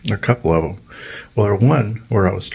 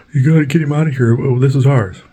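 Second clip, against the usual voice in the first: high, loud, fast neighbor voice; rushed, high-pitched complaining neighbor; mock shrill neighbor; 7 semitones higher, 4 dB louder than usual. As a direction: imitate the pushy neighbor high and quick. Neighbor's demand, acted.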